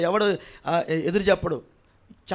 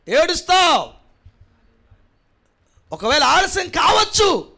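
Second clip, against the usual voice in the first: rising, ringing pulpit shout; preaching at full force; 8 semitones higher, 4 dB louder than usual. Acted. Pastor warning the congregation about conscience, preaching at full force. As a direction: hammer each line home to the congregation.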